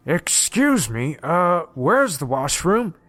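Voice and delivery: slow, exaggerated British mimicry; mock British accent. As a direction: draw out a hammed-up British accent, mocking someone. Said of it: "He said it in a British accent, mocking the guy".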